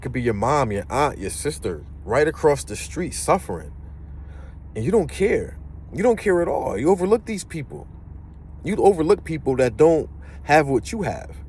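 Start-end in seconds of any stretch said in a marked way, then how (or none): 4.8-11.4 indignant. Real, not acted. Rant against rich people ignoring suffering.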